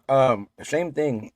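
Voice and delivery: talking around ice; chewing ice while talking; mouth full. Real, not acted. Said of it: "I got ice in my mouth".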